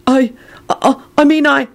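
stammering. Acted. Neville stammering.

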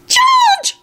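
very high, drawn little squeak; tiny piping squeal. A narrator's reading. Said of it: "squealed in his tiny piping voice".

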